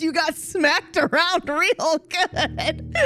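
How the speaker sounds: real tearful laughing quote; laughing through words. Real, not acted. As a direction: choke it out laughing. Quoting doctor through laughter.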